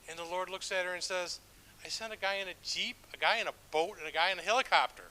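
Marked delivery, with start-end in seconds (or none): 1.8-5.1 patient rebuke. Acted. God's exasperated reply.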